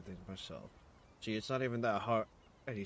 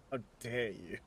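mock offended. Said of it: "overly exaggerated, like, offended face".

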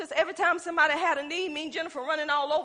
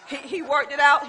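louder, laughing praise of the Lord; louder joyful praise; 8 dB louder than usual. Real, not acted. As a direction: lift it up, rejoicing. Repeated praise building.

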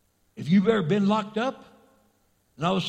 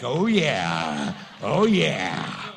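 gleeful; 9 semitones lower, 3 dB louder, much slower than usual. Retold or imitated. Freed person celebrating.

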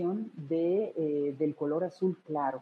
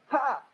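high, loud kiai ja; martial shout ja; 8 semitones higher, 3 dB louder than usual. Shown as a demonstration. Teacher showing the right karate shout, high and loud. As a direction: bark ja like a karate yell.

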